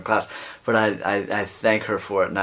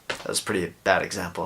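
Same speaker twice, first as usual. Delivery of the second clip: ordinary talk, nothing marked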